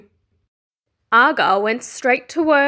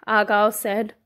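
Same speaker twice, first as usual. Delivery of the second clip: ordinary delivery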